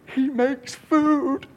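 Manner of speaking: slow, drawn-out high-pitched amazement; marvelling, long high vowels; awestruck disciples. Acted. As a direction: stretch every word out in hushed-crowd wonder. Disciples awed at the miracle.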